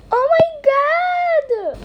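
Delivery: a kid's hammed-up shock; shocked shriek. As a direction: squeal it in horror. Shocked cry in skit.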